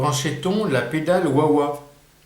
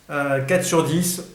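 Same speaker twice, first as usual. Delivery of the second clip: ordinary talk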